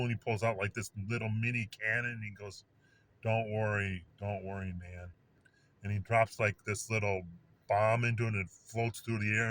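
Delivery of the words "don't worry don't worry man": low, easy reassurance, trailing softer; calm and cool. Retold or imitated. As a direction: play it unruffled, dropping lower and quieter. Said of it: "The guy's real calm and cool".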